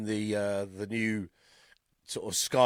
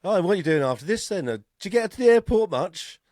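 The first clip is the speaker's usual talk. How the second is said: chatty cabbie questions; 6 semitones higher, 6 dB louder than usual. Retold or imitated. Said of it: "It's kind of like going".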